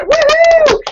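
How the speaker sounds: high loud celebratory woohoo; cheer. Real, not acted. Leader cheering her gold medalist, loud woohoo. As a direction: let out a big woohoo.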